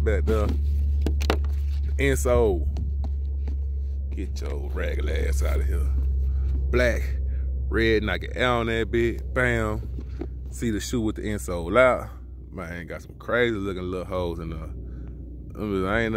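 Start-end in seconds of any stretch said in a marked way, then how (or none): none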